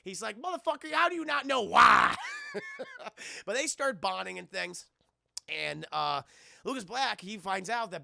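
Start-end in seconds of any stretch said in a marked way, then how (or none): 0.4-2.1 laughing, loud, indignant outburst; exasperated shout. Retold or imitated. [name] exasperated at question. 2.2-3.4 a high squeaky burst of laughter. Real, not acted. Streamer laughing at his own impression, high squeal.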